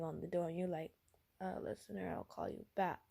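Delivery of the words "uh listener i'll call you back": soft, tired sick voice; hurried but weak. Acted. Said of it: "I put my sick voice on".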